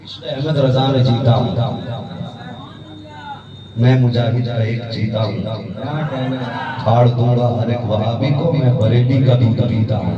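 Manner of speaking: declaimed verse with long pauses; poem recitation. Acted. Cleric reciting a defiant poem to the crowd, declaimed. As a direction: declaim each line then pause.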